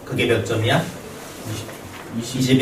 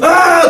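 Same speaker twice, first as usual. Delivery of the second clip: sudden high yelp of shock; startled cry; 13 semitones higher, 6 dB louder than usual. Retold or imitated. Teacher reliving stepping in dog mess, startled yelp.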